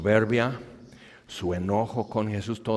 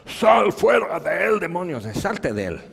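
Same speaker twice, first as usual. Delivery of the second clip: commanding shout; 8 semitones higher, 6 dB louder than usual. Acted. Jesús a los demonios: grito de mando. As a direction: order the demons out at full force.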